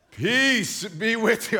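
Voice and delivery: slow, solemn mock-holy voice; lightly dramatic Jesus. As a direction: hold each word like a grand entrance. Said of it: "and Jesus says".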